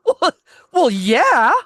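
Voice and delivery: high, loud mock disbelief; incredulous retort. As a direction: blurt it out high, as if stating the obvious. Retold incredulous reply to clerk.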